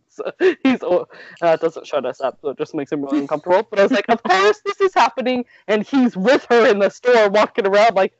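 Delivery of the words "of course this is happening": exasperated. Retold or imitated. Mock exasperation at bad luck.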